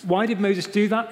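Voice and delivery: ordinary delivery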